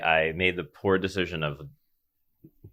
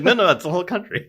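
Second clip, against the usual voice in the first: laughing it off, high; giggling through the line; 10 semitones higher than usual. Retold or imitated. Friend's laughing reply quoted.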